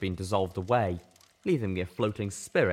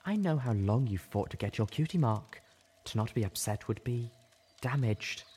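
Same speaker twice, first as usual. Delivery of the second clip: kind reassurance; 3 semitones higher than usual. Acted. Velvet reassuring Little Pip about her cutie mark, kind. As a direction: soothe her gently. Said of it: "an odd but kind look".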